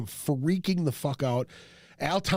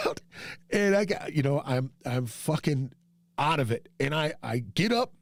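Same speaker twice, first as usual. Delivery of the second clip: ordinary talk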